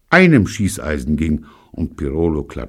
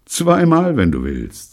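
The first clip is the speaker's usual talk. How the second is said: offhand; 11 semitones higher, 6 dB louder than usual. Acted. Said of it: "sagte Gordon leichthin".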